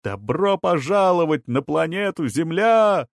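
high, exuberant shouted welcome; shouting. A narrator's reading. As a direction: hail the newcomers at full pitch. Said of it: "кто-то ещё кричал".

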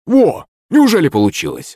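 sudden high excited yelp. A narrator's reading. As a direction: jump up high on the first word. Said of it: "напрягся слуга".

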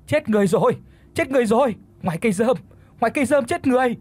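high, panicked calling out; echoing alarm cries. A narrator's reading. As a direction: chime in with frantic high shouts. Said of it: "miệng thì cũng phụ họa".

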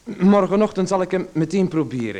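keen reassurance; eager. Acted. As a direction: promise it brightly to soothe him. Eager promise to placate visitor.

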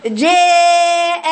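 جاء held very long as a tilted "jee-eh"; faulty, thinned vowel drawn through جاء; second wrong reading. Shown as a demonstration. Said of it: "تيجي الخطأ الثاني".

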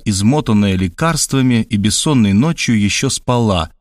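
plain reading voice

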